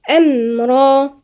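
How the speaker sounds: أمرا with a half-held, resonant mim; slow أمرا; tajweed demo; demo of medium mim. Shown as a demonstration. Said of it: "الميم دي هي توسط".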